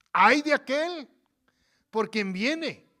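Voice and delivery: loud, high cry on ay de aquel; preached exclamation. Real, not acted. Preacher warning the congregation, loud high cry. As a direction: proclaim it loud like a warning from the pulpit.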